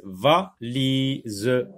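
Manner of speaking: "valise" split into va, li, se; syllable by syllable. Shown as a demonstration. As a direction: sound out each syllable of "valise" separately. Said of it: "Dans la première syllabe".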